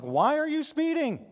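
high, slow self-scolding question; scolding himself. Retold or imitated. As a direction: ask yourself in disbelief, drawn out. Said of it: "And I'm like".

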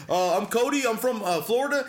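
a flustered guy caught off guard; nervous cameraman. Retold or imitated. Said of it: "And he's like".

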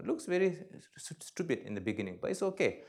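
usual speaking voice

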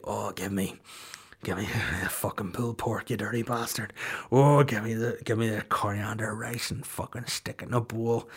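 mock seductive phone order; flirty crude order. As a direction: murmur it suggestively.

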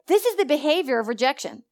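ordinary talk, nothing marked

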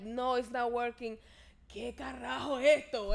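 mock Spanish-accented frustration. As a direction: grumble in a put-on Spanish accent. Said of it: "This is all in a Spanish accent".

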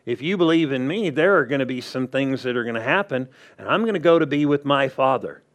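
quick paraphrase. Retold or imitated. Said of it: "He basically said".

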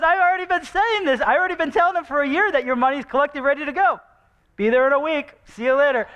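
high, quick, playful needling; teasing. Retold or imitated. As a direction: tease them like it's no big deal. Mock-breezy Paul voice.

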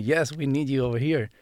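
ordinary talk, nothing marked

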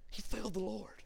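very soft, hushed repeat. Real, not acted. Preacher on Peter's broken heart, hushed. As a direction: drop right down and let it sink in.

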